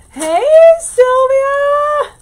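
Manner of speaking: loud, high, drawn-out holler; big stretched-out shout of hello; excited call-out greeting. Real, not acted. Excited greeting to arriving viewer.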